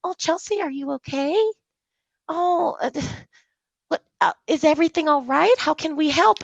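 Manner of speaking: high-pitched, fussing coworkers; mock concern. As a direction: imitate overly solicitous colleagues checking on someone. Imitates coworkers fussing over friend.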